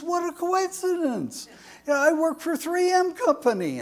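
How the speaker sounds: high, quick, pleased exclamation; delighted new dad. Acted. A second father boasting to the nurse, high and fast. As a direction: rattle off the brag with glee.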